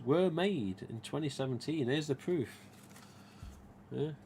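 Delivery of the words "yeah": ordinary delivery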